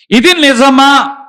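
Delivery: loud, high, slow ఇది నిజమా with weight on ఇది; emphatic question. Shown as a demonstration. Preacher voicing Socrates' question to the church, loud and slow. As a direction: drive the question home to the crowd. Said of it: "ఇది నిజమా అన్నాడట".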